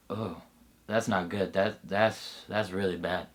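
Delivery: quiet, crestfallen realization; dismayed hush. Acted. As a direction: let the bravado drain out into worry. Sudden dismay at bad news.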